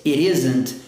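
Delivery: "it isn't" ligado, T soando como R; flapped T in it isn't. Shown as a demonstration. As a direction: tap the T of "it" so it sounds like "iri isn't". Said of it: "o T trabalhando com som de R".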